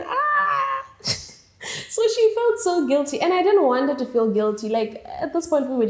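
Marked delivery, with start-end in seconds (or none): 0.1-0.8 sudden high yelp. Retold or imitated. Reacting to her friend's bombastic rant, sharp high yelp. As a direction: let out a sharp high cry.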